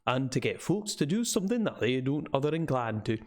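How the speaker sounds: put-on old local man's northern English with Scots touches; rustic northern English, slipping toward Scottish; northern English dialect. Old man scoffing to visitors about warnings, northern English dialect. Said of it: "like a very northern English".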